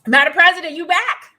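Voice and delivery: loud, high call to a guest whose line dropped. Real, not acted. Host calling to the returning guest, loud and high. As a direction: hail her back loudly, pitch up.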